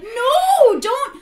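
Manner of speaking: high slow refusal; exasperated protest. Real, not acted. Arguing with the song lyrics, high drawn-out protest. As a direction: plead against the lyric.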